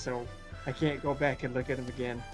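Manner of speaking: usual speaking voice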